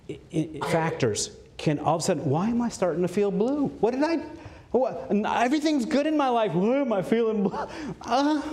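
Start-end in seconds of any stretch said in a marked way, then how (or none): 2.3-8.4 high, puzzled protest; acted-out confusion, rising at the ends; bewildered self-questioning. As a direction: wonder aloud in a high, baffled voice. Acting out a bewildered depressed person.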